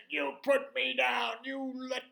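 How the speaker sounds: high, loud, furious man's screaming; angry yelling. Acted. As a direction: bellow demands in outrage. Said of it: "a real angry voice said".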